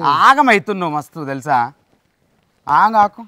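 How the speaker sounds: exasperated and forceful, pitch jumping up; loud scolding. Real, not acted. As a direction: shout some sense into a panicking friend. Scolds panicking companion to calm down.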